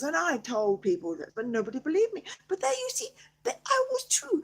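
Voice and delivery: plain reading voice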